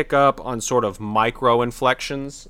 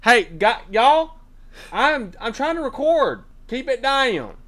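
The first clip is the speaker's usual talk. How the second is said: shouting; 12 semitones higher, slower than usual. Acted. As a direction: yell at the noise to keep it down. Yelling at noisy family.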